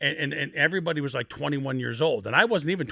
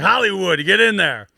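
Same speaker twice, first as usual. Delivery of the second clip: loud higher barked command; coach's order; 6 semitones higher, 10 dB louder than usual. Retold or imitated. Line coach sending the rookie into drills, loud command.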